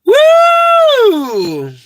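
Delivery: high, loud shrieking laughter then a whoop; howling with laughter. Real, not acted. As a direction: let it burst out in a shriek. Cracking up at own joke.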